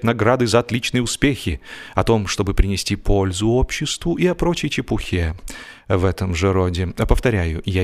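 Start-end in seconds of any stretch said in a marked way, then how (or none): none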